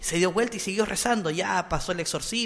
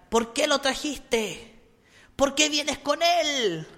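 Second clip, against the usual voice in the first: high, slow, eerie demon voice rising to a whispered shriek; retold demon voice of a possessed girl; possessed girl screaming; 6 semitones higher, slower than usual. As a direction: channel the demon in the girl, accusing. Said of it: "gritaba la niña endemoniada".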